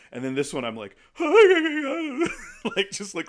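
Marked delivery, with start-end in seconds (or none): 1.2-2.7 loud, high mock sobbing wail; hammed-up bawling, falling at the end; losing it. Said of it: "losing my shit".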